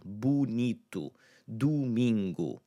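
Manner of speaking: slow "Bonito, domingo" with boo and doo; careful boo-NEE-too, doo-MEEN-goo; first O as oo. Shown as a demonstration. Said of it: "that oo sound in that first syllable".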